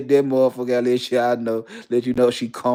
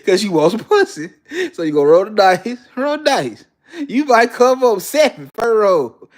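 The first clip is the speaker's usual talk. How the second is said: hyped up; 7 semitones higher, 4 dB louder than usual. Acted. Hyped gambling scene.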